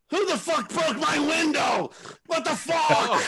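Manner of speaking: high, drawn-out mock shout; imitated outrage. Roommate discovers his broken window, hammed-up angry shout. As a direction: yell it like the outraged roommate, stretching the words. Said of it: "he sees the glass and goes".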